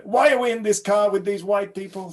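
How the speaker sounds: loud, high indignant demand; really angry. Retold or imitated. Girlfriend confronting him in the car, angry. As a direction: imitate an outraged girlfriend's complaint. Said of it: "she looked really angry".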